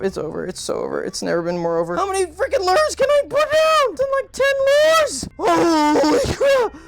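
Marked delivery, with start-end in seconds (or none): 1.9-5.3 very high, louder desperate squeal; shrieking in disbelief at the lures; real panic; panicked shriek. Real, not acted. Overwhelmed by the enemy. 5.4-6.8 real terrified whimpering; crying in fear. Real, not acted. Jump scare, crying out.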